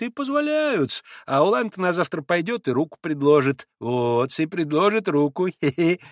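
lightly voiced fawning old landowner; sly obsequious chatter ending in a giggle; Maximov's fawning voice. A narrator's reading. Maximov tells of Polish girls, fawning and giggling.

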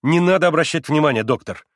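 mildly exasperated appeal; somewhat impatient. A narrator's reading. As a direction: insist with a touch of impatience. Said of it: "повторил он уже несколько нетерпеливее".

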